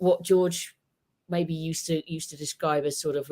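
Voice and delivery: ordinary talk, nothing marked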